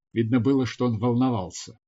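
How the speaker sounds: ordinary delivery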